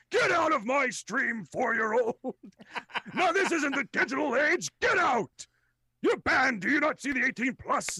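theatrical streamer rage; mock outraged shout. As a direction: banish the kid in hammed-up fury. Streamer mock-kicking out viewer.

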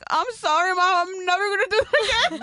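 daughter's apology. Retold or imitated. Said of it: "She's like".

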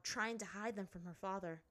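ordinary delivery, nothing marked

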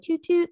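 high, playful toot-toot; playful horn toot. Pretend. Shouting herself out over the weight loss, playful horn toot.

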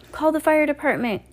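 high, desperate plea; desperate cry. A narrator's reading. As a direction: cry it out in panic. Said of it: "Violet cried desperately".

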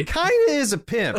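high mock-serious agreement; squeaky joking agreement. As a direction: toss it off in a silly high voice.

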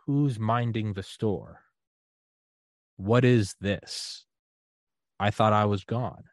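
very slow, hushed puzzlement; slow wondering. Retold or imitated. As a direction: let each question hang in awe. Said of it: "it dawned on me".

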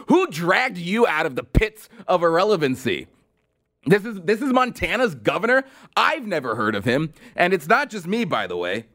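ordinary talk, nothing marked

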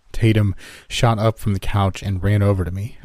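plain reading voice

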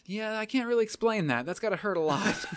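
laughing while conceding. Retold or imitated. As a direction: say it through a laugh. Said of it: "and I'm like".

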